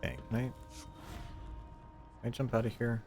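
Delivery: normal talking voice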